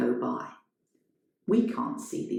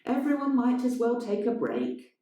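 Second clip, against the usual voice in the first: calling into theatre; 7 semitones higher than usual. Acted. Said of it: "Elena calls into the theatre".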